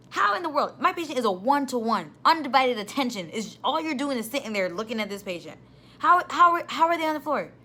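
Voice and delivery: high, loud, outraged questions; indignant rant. Real, not acted. Nurse outraged her patient fell unsupervised, indignant. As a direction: demand answers like a furious charge nurse.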